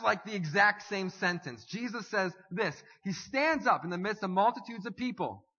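normal talking voice

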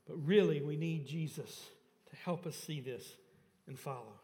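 ordinary delivery

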